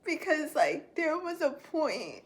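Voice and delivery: a touch of crying, in relief; tears of relief. Real, not acted. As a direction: speak through happy tears. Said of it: "tears of relief and joy".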